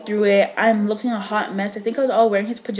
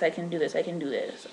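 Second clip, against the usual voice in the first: softer, murmured self-encouragement; quiet self-talk; 5 semitones lower, 9 dB quieter than usual. Retold or imitated. Woman in labor telling herself she can do it, quietly. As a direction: psych yourself up under your breath.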